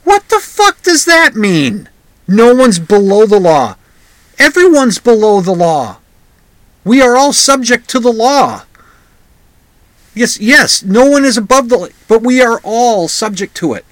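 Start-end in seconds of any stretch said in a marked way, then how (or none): 0.0-11.9 angry outburst. Pretend. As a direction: blow up at the guest in disbelief. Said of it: "look at him and say".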